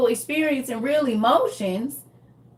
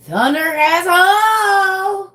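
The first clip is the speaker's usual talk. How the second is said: drawn-out call; 8 semitones higher, 4 dB louder, much slower than usual. Real, not acted. Calling out a greeting.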